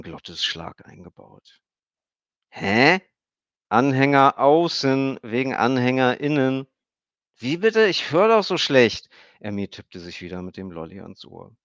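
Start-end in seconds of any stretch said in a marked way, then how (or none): 2.5-9.1 put-on high cheeky girl's voice; a kid playing hard of hearing; little girl. A narrator's reading. Emmy teases Stefan about her hearing, high girl's voice.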